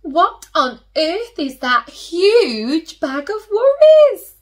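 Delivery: put-on high, loud, startled old lady voice; little old lady. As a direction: gasp it like a surprised granny. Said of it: "the little old lady said".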